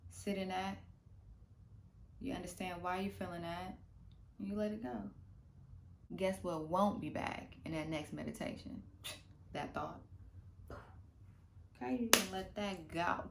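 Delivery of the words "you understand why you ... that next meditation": normal talking voice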